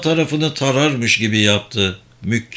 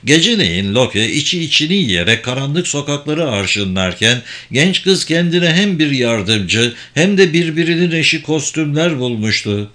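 plain reading voice

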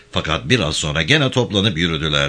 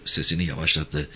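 ordinary delivery, nothing marked

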